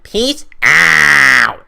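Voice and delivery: stretched loud peace out; drawn-out sign-off yell. Real, not acted. Vlogger signing off to viewers, loud drawn-out yell. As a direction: shout the farewell long and slow.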